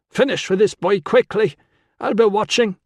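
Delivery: high, loud commanding man; Con's order. A narrator's reading. Con ordering Tilla to hurry with the boy, high and loud. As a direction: bark the order at her.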